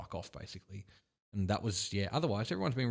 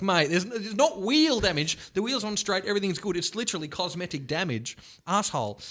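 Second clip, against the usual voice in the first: exasperated retort; 8 semitones higher than usual. Retold or imitated. Arguing with the cop.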